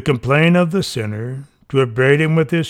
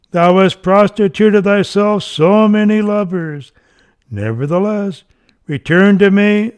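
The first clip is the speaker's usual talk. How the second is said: raised, louder voice pleading the straying soul home; coaxing plea; 5 semitones higher, 4 dB louder than usual. Acted. God coaxing the sinful soul to come back, raised urgent plea. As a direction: call them back, urgent but loving. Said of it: "coaxing the sinful soul to come back".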